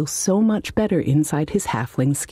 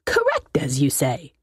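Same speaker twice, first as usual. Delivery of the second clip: smug wizard. Acted. Wizard answers.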